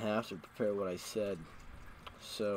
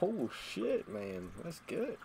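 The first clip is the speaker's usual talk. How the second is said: impressed with himself; 10 semitones higher, slower than usual. Retold or imitated. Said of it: "I'd be like".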